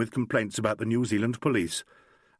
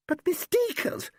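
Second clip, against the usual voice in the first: protesting Valerie; slower than usual. A narrator's reading. Valerie voiced, far higher.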